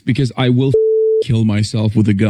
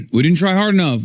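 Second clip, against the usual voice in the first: mock blame; 7 semitones higher than usual. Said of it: "mocking the blame line".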